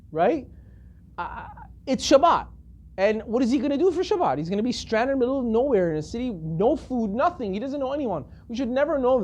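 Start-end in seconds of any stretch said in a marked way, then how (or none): none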